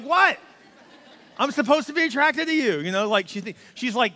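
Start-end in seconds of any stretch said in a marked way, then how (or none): 0.0-2.8 high, loud and laughing, playfully protesting; husband's mock outrage at wife's teasing; mock indignant surprise. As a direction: act offended but laugh through it. Pastor protests wife's reaction.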